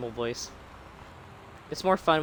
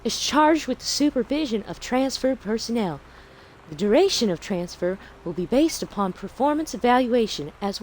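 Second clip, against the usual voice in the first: really bad Southern Belle accent. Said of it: "the really bad Southern Belle".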